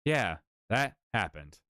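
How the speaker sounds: booming metallic robot read. Shown as a demonstration. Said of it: "the robot voice for you".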